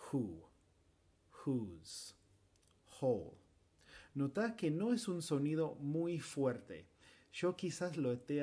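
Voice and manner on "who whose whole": "who", "whose", "whole" with an h sound; h in wh words. Shown as a demonstration. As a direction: breathe out the start of "who". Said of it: "Con W".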